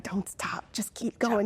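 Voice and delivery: hushed, whispered instruction; retold whisper. Woman secretly telling her friend to keep driving, whispered. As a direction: whisper it as if sneaking out unheard. Retold sneaking out of the house quietly.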